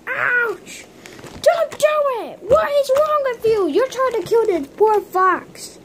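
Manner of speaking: childlike mock outrage; high indignant figure. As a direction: squeal at the attacker. Hurt figure scolds attacker.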